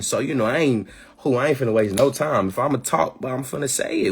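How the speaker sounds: ordinary talk, nothing marked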